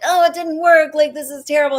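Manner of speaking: the melodramatic reaction she avoids, overplayed; mock despair. Shown as a demonstration. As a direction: wail it like a failure is a disaster. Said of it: "I don't go like".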